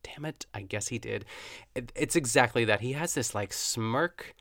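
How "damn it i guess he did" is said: hushed, breathy surrender; soft whispered admission of defeat; grudging swoon. Acted. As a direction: give in under your breath, charmed. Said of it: "he does something and you're like".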